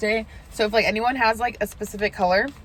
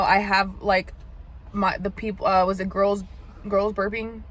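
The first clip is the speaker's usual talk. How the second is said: normal talking voice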